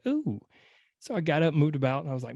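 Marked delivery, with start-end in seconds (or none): 0.1-0.4 high startled "ooh" at a twinge; pained ooh. Retold or imitated. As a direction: react to a sudden odd twinge in the neck. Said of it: "felt a little something weird".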